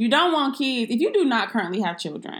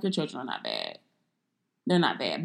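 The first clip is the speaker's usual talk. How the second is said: much lower and softer, gently reassuring; soft reassurance; 9 semitones lower, 5 dB quieter than usual. Retold or imitated. Host reassuring a parent their children aren't bad, gentle and soft. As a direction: talk a worried parent down softly.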